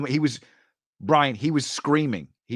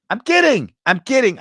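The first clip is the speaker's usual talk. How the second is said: high, loud "kidding"; loud, high-pitched protest; emphatic joking retraction; 12 semitones higher, 8 dB louder than usual. Real, not acted. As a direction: cry it out to take back the joke. Takes back his restraining-order joke.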